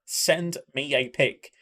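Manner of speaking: drawn-out request, each word set apart; slow, deliberate. Real, not acted. Streamer repeating the request to a viewer, slow and deliberate. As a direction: spell it out word by word.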